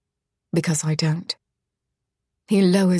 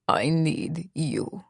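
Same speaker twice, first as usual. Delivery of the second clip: very slow, softer, lowered hiss through teeth; hissed through clenched teeth; 2 semitones lower, 6 dB quieter, much slower than usual. A narrator's reading. Misha confessing need to Charlotte, slow clenched hiss. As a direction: grind out each word with jaw locked. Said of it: "he hisses through clenched teeth".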